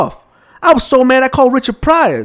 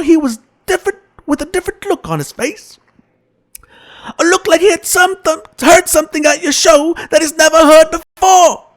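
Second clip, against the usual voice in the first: high, sing-song fatherly voice; a lilting storytelling dad; Bill Cosby impression; 4 semitones higher than usual. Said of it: "That's when Bill got pissed".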